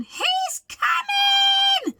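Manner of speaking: a very high, drawn-out shriek of fear; terrified shriek. Acted. Mouse shrieks to warn everyone of Big Bro. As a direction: shriek the alarm. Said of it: "shrieked the mouse".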